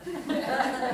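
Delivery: a quick high giggle; high laugh. Real, not acted. Preacher laughs at his kilt joke, high giggle. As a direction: laugh at your own joke.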